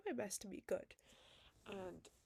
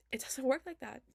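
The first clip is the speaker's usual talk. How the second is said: emphatic louder; 6 semitones higher, 9 dB louder than usual. Real, not acted. Repeated line, loud then whispered.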